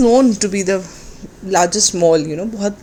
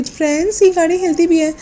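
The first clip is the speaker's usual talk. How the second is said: mock amazed vlogger; 8 semitones higher than usual. Vlogger mockingly imitated telling friends the car moves, gushing. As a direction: ham up the shallow wonder of a vlogger. Mimicking creator's amazed address to viewers.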